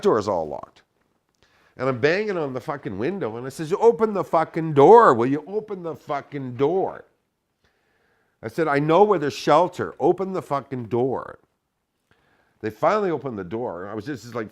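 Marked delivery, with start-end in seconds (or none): 3.7-7.0 higher, much louder enraged shout; furious yell. Retold or imitated. Pounding the car window, screaming at friends to open. 8.7-11.4 higher, much louder enraged shout; furious yell. Retold or imitated. Pounding the car window, screaming at friends to open.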